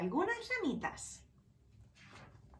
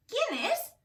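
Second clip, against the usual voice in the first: a mild call into the dark; high mock shout of a startled mouse; 9 semitones higher than usual. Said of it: "grita Ratón".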